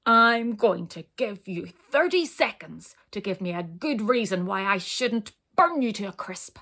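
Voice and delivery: loud menacing dragon bellow. Acted. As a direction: bellow the threat at the tiny intruder. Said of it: "bellowed the dragon".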